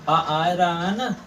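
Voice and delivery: slow, stretch आ so the hidden vowel is heard; stretched vowel demo. Shown as a demonstration. Teacher showing pupils the vowel inside क, slow stretched आ. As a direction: drag out आ slowly to show the vowel sound. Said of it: "क आप बोलेंगे तो आएगा आवाज़ क".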